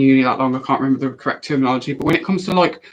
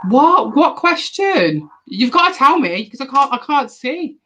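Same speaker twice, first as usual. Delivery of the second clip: startled confusion; 9 semitones higher, 4 dB louder than usual. Real, not acted. Confused by a missing question.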